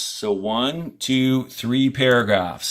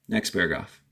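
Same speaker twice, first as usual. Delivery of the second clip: normal talking voice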